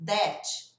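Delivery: "That" said as "dat" with a hard D; wrong D for TH. Shown as a demonstration. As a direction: mimic the common D mistake. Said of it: "começam a colocar um som de D". ("That" errado, como "dat")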